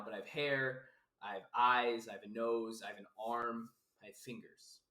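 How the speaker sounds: ordinary talk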